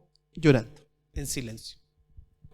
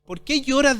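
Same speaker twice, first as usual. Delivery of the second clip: loud, raised preacher's question; emphatic challenge; 6 semitones higher, 9 dB louder than usual. Acted. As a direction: call it out as a challenge from heaven. Voices heaven's challenge to Mary.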